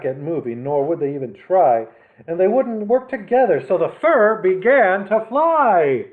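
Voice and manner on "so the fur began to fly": ordinary delivery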